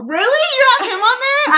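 high, loud incredulous really; shocked disbelief; surprised. Real, not acted. Surprised reaction.

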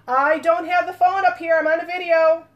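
loud, high call across the house; yelling across the room. Real, not acted. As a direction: shout it to someone downstairs. Calling out to someone elsewhere.

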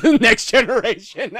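high, loud, helpless laugh through the words. Real, not acted. Naming the film sequel to a co-host, laughing. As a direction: burst out laughing as you say it.

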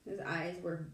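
plain reading voice

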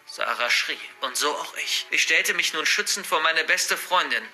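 plain reading voice

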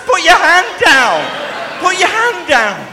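loud, high, drawn-out scolding order; exasperated yell, slow and high. Retold or imitated. Speaker scolding his waving friend in the queue, loud and high.

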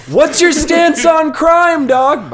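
high, loud, drawn-out hammed-up question; eager. Itachi sizes up a new friend, hammed-up eagerness. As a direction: stretch it out way up in pitch.

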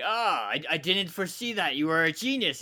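high, loud mock-gushing admiration; mock amazement. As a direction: gush like a stunned admirer, hammed up. Retells admirer gushing at genius.

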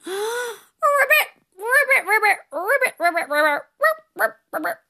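high, bouncy frog croaks; frog ribbits. Shown as a demonstration. Host leading kids' breathing with playful frog ribbits. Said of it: "one more time".

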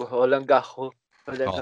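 Roland Garros with a French accent, final s dropped; French pronunciation. Shown as a demonstration. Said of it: "gastou o francês agora".

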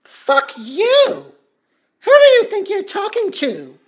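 loud, furious comeback; furious outburst. Acted. Host to caller, loud furious outburst. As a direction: explode at the insult.

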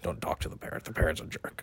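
unvoiced whispered aside; whispered pirate aside. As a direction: whisper it behind the parrot's back. Pirate aside about parrot.

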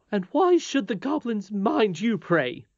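high, loud, slow and scornful; a prim nurse scoffing; haughty. Acted. Nurse challenges boy.

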